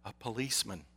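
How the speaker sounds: put-on soft, hushed child on the phone; gefluisterd kinderstemmetje. A narrator's reading. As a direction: speak low and small, like a secretive child. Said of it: "whispered the child".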